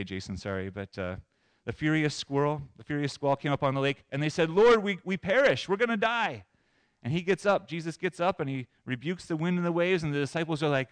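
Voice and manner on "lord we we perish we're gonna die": raised, urgent cry for help; mock panic, pitch pushed up; panicked plea. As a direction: disciples crying out in alarm. Disciples cry out in storm.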